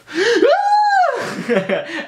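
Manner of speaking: real laugh, rising high; a squealing giggle; high burst of laughter. Real, not acted. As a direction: laugh freely at the joke. Laughing at own joke.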